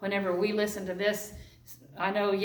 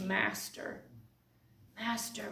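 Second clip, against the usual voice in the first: awed, hushed; 9 dB quieter, slower than usual. Acted. Mary's awed recognition of Jesus.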